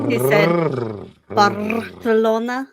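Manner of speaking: Barcelona with a strong trilled R; rolled R Barcelona. Shown as a demonstration. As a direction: roll the R in Barcelona hard. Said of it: "you wouldn't roll the R that much".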